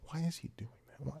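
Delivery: quiet, puzzled mock voice of the other golfers. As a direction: mimic their baffled stare, hushed. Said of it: "they would be like".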